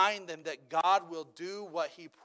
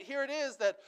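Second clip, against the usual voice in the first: usual speaking voice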